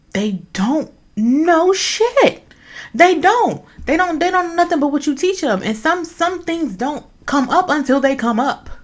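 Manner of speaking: loud, high-pitched impassioned insistence; emphatic insistence. Real, not acted. Insisting passionately on her point.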